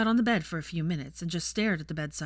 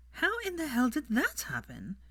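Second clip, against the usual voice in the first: disbelief; 9 semitones higher than usual. A narrator's reading. Daphne baffled by the news.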